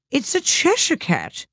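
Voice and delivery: high, slow put-on character voice; Duchess. A narrator's reading. Duchess answers Alice about the cat, high put-on voice.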